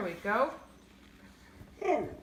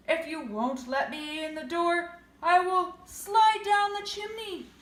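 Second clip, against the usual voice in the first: loud, higher menacing wolf; 4 semitones higher, 5 dB louder than usual. Acted. Wolf on the roof warning the pig, loud. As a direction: build the threat to a loud finish. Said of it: "he said".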